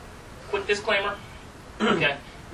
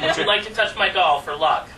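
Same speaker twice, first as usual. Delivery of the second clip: pointed repeat; 3 semitones higher, 6 dB louder than usual. Acted. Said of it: "Let me ask you again".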